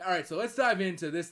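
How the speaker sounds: high, loud put-on presenter voice; mock show voice. Host mocking his own show-opening voice to co-host, hammed-up. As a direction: kick off the show with exaggerated hype. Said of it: "as if I talk like this".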